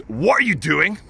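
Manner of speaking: a cop calling out from the car; police officer. Retold or imitated. Police officer to the smoking vlogger, stern challenge. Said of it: "and goes".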